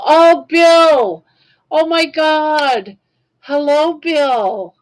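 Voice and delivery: high, loud burst of joy; thrilled greeting. Real, not acted. To his hero Bill joining the stream, a loud, high, overjoyed greeting. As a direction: greet him like you can't believe he's here.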